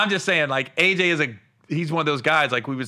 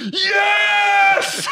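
cheering shout; 6 dB louder than usual. Retold or imitated. Cheering during national anthem.

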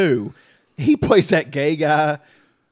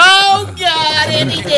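exasperated groan; 11 semitones higher, 3 dB louder, slower than usual. Real, not acted. Reacting to Andy Dick.

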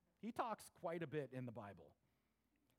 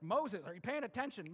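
mildly exasperated, raised voice; impatient calling; 4 semitones higher, 5 dB louder than usual. Acted. God trying to get Moses' attention, impatient. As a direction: get someone's attention, a bit louder and higher.

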